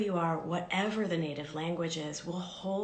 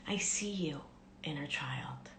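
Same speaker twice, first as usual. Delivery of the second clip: soft whispered reassurance, fading; tender whisper; 3 semitones lower, 5 dB quieter than usual. Shown as a demonstration. Speaking to the inner child, tender whisper. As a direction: hush it lovingly to a child. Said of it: "you can even start by saying".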